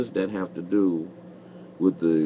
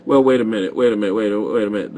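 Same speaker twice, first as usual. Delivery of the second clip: louder and higher, cutting in; loud interruption; 4 semitones higher, 9 dB louder, faster than usual. Real, not acted. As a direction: stop the caller mid-sentence, loud and firm. Host interrupts caller loudly.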